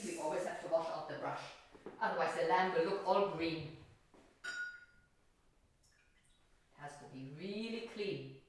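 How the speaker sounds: ordinary talk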